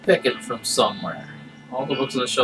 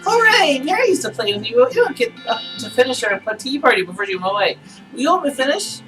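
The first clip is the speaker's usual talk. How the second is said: high, cheery doll voice; squeaky doll, bubbling with joy; talking doll; 13 semitones higher than usual. Acted. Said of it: "Pull the string".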